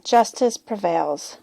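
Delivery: ordinary delivery, nothing marked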